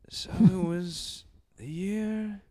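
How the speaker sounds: slow, dramatic storyteller voice; story narration. Acted. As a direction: set the scene like an epic tale.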